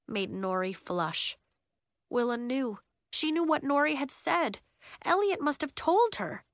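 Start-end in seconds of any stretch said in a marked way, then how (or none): none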